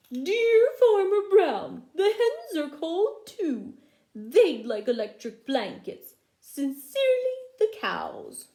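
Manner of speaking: cows' letter voice. Acted. Teacher reads the cows' note to the children in a high, loud cow voice. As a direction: read it as a silly squeaky cow.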